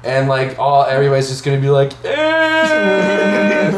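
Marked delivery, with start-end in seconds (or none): 2.1-3.8 very high sung "eh"; high playful sung shout; mock cheer. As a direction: sing out a high group "eh". Everybody's reaction to gag.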